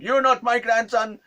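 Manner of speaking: very high, loud mock accusation; angry old man. Old man angry at supposed grandson, shouting. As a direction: imitate an old man's angry cry. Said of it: "And he was angry".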